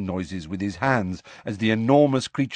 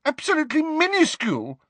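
hysterical sarcasm; faster than usual. A narrator's reading. Hermione mocking the word tiny, hysterically sarcastic. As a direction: fling the word back in shrill sarcasm. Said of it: "with a kind of hysterical sarcasm".

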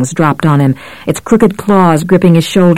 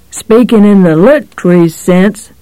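sly, grinning cop voice; amused menace, lightly voiced; 3 semitones higher than usual. A narrator's reading. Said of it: "the cop said, grinning".

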